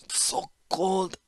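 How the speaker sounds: faint, breathless whisper; weak whisper. A narrator's reading. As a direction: barely get the words out, chilled. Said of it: "managed the Falaji".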